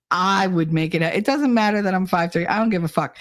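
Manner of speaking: ordinary talk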